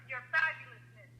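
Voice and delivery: ordinary talk, nothing marked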